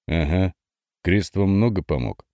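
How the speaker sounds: ordinary delivery, nothing marked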